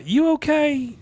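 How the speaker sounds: very high mock-female voice; imitated concerned woman. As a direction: pitch it way up as her asking. Voices woman checking on Hank.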